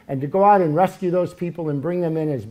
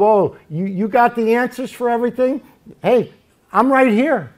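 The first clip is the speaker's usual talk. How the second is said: high, loud sarcastic challenge; 7 semitones higher, 5 dB louder than usual. Acted. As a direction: taunt Job as God, raised and loud. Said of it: "sarcasm. He's saying to Job".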